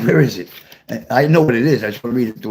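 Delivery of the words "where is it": usual speaking voice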